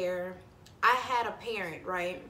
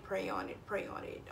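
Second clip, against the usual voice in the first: trailing off to a whisper; fading repetition; 3 semitones lower, 9 dB quieter than usual. Acted. Telling viewers her only option, fading to a whisper. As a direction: let it fade to a whisper.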